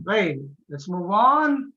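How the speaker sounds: ordinary talk, nothing marked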